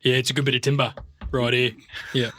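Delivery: joining in with blokey Australian; put-on Australian bushman; manly Aussie accent. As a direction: play along as a manly Aussie. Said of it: "put on an Aussie accent".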